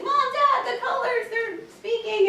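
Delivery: high, loud burst of retold excitement; excited child. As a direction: act out an excited kid calling to parents. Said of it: "he's so excited".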